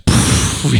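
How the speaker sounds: long, voiceless puff of air; deflating exhale. Retold or imitated. Storyteller imitating Jonah Hill's character after the firing, deflated exhale. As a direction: blow the air out like someone deflating. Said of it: "like, deflates".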